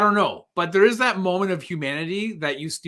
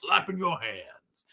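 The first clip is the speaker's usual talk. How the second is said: slow mocking drawl; 5 dB quieter, slower than usual. Imitating Plainview mocking.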